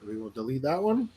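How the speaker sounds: words muffled by a pen; pen in teeth. Real, not acted. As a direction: speak with a pen between teeth. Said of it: "He's got a pen in his mouth".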